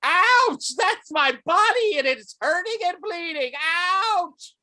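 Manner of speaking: very high, loud yelps of pain; screaming in pain. Acted. Game master voices a slashed master, screaming in pain. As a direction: wail it out, high and hammed-up. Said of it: "as he screams".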